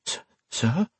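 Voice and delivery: high, soft stammered question; hesitant, shaken. Acted. Frightened servant questions his doubting master.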